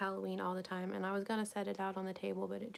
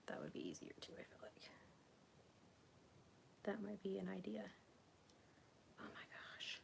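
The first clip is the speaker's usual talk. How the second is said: whispered aside; 13 dB quieter than usual. Real, not acted. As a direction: mutter in frustration to yourself. Frustrated aside while crocheting.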